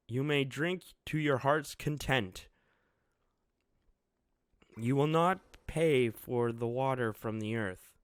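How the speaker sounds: put-on proclaiming young lord. Momo's speech to Wano.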